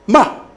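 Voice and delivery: "Ma" short, starting high and falling sharply; fourth tone. Shown as a demonstration. Teacher demonstrates the last tone, falling "Ma". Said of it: "le dernier ton".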